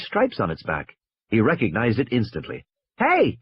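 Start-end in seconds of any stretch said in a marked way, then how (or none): 3.0-3.4 sudden high shout; a boy yelling to the driver, high and sharp. Acted. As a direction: call out urgently to stop them. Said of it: "he shouted".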